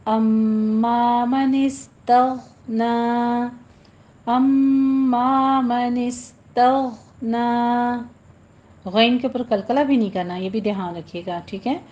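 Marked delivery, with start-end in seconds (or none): 0.1-8.1 slow measured Quranic chant; tajweed recitation. Shown as a demonstration. Teacher models the verse for students, slow recitation. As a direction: draw each vowel out like a reciter.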